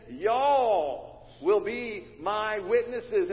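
slow Southern "Y'all will be my witnesses", stressing "Y'all"; Southern plural. Shown as a demonstration. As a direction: punch "Y'all" with a drawl. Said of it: "He's saying".

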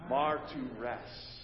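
"Martyres" said as mar-tu-res, the R's sounded; Greek martyres. Shown as a demonstration. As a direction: pronounce "Martyres" slowly, syllable by syllable. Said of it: "One last time".

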